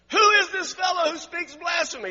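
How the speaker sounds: high, loud outraged shout; indignant Pharisees. Acted. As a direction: cry out in scandalised disbelief.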